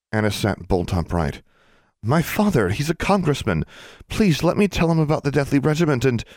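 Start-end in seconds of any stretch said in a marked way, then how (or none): 2.0-6.2 high, eager pleading voice; urgent pleading. Acted. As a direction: beg to be allowed. Anna pleading, pitch jumps high.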